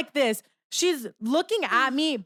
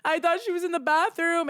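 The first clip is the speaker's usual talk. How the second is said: higher, mock tearful whine; retold crying; 5 semitones higher than usual. Telling the guards her friend is missing, mock tearful. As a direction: imitate a tearful explanation. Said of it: "I start crying to the security guard".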